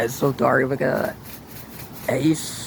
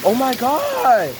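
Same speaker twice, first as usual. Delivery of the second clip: in a hailstorm; 8 semitones higher than usual. Real, not acted. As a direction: shout in amazement at the hail. Still hailing, exclaiming loudly.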